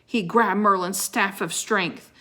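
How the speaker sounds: usual speaking voice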